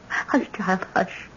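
soft hush, almost under her breath; quiet the child gently; hushing softly. Acted. Grandmother softly hushing the girl.

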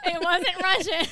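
loud, high, laughing hard. Real, not acted. Admitting the failed accent to co-host, laughing loudly. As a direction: crack up slowly through the words.

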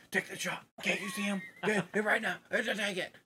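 whisper-yell the order to shoot; stage whisper. Retold or imitated. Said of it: "he's like, whisper, yelling".

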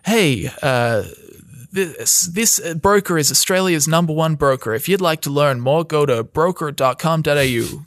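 upbeat American ad read; American accent. Pretend. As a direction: voiceover announcer in American accent. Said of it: "I don't know why I said that in American accent".

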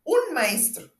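insist, allowing no doubt; peremptory. Acted.

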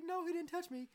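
put-on high, creepy child voice; creepy high-pitched kid voice. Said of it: "sorry about the creepy voice".

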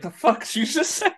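high, incredulous mock outrage; hammed-up baffled retort; mock disbelief. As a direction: act baffled by the slang. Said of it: "I'd be like".

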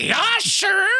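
high shouted upper Midwestern yelp; mock upper Midwestern hype shout; Lil Oli voice. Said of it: "horrible m-, upper Midwestern accent".

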